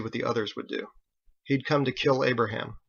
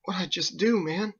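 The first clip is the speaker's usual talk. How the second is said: shaken; 7 semitones higher than usual. Acted. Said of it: "Ditch trembled and swallowed".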